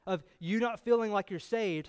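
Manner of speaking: usual speaking voice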